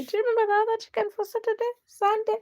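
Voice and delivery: high, fast, squealing rush; giddy excitement. Real, not acted. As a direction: gush about a shared treat. Excitedly recalls chicken with DIY.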